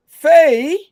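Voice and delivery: a high, prissy English-style "aren't you gonna have a wash"; mock English accent. Brother back from London speaks to Faye in a mock-posh English accent. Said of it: "you went to England five days".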